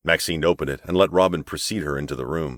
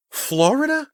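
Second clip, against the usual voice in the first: Florida? much higher, louder, scoffing; appalled echo; 4 dB louder, slower than usual. A narrator's reading. Snobbish guest echoing the bride's answer, appalled. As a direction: repeat "Florida?" as if it were an insult.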